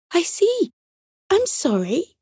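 high-pitched, sheepish apology; a little abashed. A narrator's reading. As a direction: apologise in a small bird's high voice. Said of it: "looking a little abashed".

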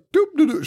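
high, light doop-doop walking noise; singsong little doops; carefree humming. Retold or imitated. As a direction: imitate someone bopping along without a care. Nurse strolling obliviously down hallway.

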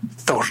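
Thor with a trilled R at the end; short clipped Thor, rolled R; Icelandic Thor. Shown as a demonstration. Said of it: "Thor would be".

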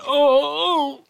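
very high mock yelp; high startled yelp. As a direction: squeak it out high. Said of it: "he's just like".

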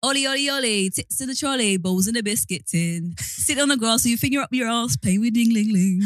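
put-on sung in a northern accent. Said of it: "why do you do it in a northern accent".

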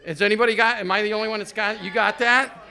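usual speaking voice

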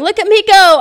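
high, loud boastful call; retold yell. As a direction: yell it out to the family. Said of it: "So I'm yelling".

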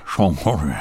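mumbled low Sean Connery impression, mouth full of marbles. Shown as a demonstration. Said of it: "My mouth is full of marbles".

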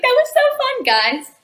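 high, bright burst of cheer; bubbly after a take. Retold or imitated. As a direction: chirp it out to the crew, all smiles. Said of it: "be like".

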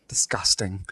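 whispered disgust. Acted. Posh banter, laughing disgust.

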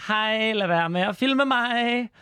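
high, slow, drawn-out singsong through a smile; fake smiling politeness. Drag queen waving at the truck driver filming them, fake-sweet and high. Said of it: "vinker til ham og smiler".

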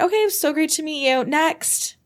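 high, quick polite brush-off; brisk casting interviewer. Retold or imitated. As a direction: imitate a sweetly hurried dismissal.

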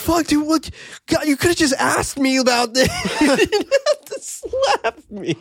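high, laughing mock complaint; hammed-up offended woman; mock indignant. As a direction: whine it high, laughing through. Voicing the slapped woman.

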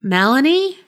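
anxious high call. A narrator's reading. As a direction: call her name, worried. Calling for missing friend.

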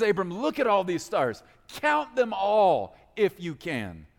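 God teasing Abram with the stars; ornery God. Acted. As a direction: dare him to count, playfully. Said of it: "God's divine ornery side".

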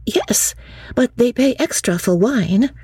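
put-on high, earnest young woman's voice; young Lucy. Lucy answering her cousin Charlotte, earnest and high.

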